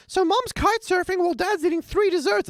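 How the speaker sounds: very high mock-Lisa voice; Lisa impression. Quoting Lisa stating the obvious, very high pitched. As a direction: pipe it up like a know-it-all kid. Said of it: "Lisa says".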